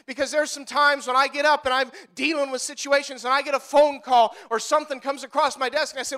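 ordinary talk

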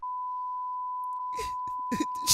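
shrill cackling laugh; high shrieking laugh. Real, not acted. Shocked by crude joke.

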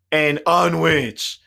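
slow, loud drawn-out mock customer; mock stubborn customer. Imitating the customer repeating his order, slow and loud. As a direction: drag the word out stubbornly. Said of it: "and then he goes".

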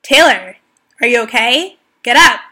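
loud, urgent shout from the coach; worried coach yelling her name; coach's yell. Retold or imitated. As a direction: call out to a fallen athlete. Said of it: "my coach yelled and said".

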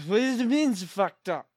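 normal talking voice

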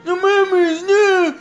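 high, loud panicked pleading; panicked yelling. Retold or imitated. Friend screaming at the horror game, high panicked pleading. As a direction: yell in terror, voice cracking into a cry. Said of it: "Y empezó a gritar".

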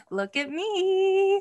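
high, slow mock gloating voice; a hammed-up smug vampire showing off; smug Edward. Cohost mocks Edward bragging in a high gloating voice. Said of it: "he can't be like".

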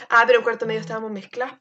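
ordinary delivery

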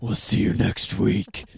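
loud gravelly Batman voice. Acted. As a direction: growl it like Batman. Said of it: "Do you wanna hear my Batman voice?".

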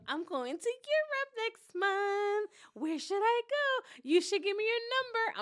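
high, slow, loud imitation; hammed-up high-pitched gushing; mock gushing friend. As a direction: drag it out in over-eager excitement. Mocking friend's gushing to stranger.

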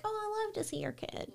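high, soft, sweet coo. Retold or imitated. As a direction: coo over a friend's kid. Cooing at friends' posts.

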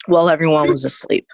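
usual speaking voice

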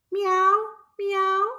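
high, mewing kitty voice; cat meowing. A narrator's reading. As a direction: mew like a cat. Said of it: "mewed the cat".

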